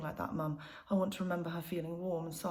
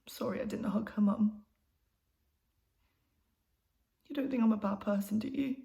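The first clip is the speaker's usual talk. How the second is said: distressed, higher-pitched plea for reassurance; pleading apology; 4 semitones higher than usual. Acted. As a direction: beg mum for reassurance. Apologising, begging mum for reassurance.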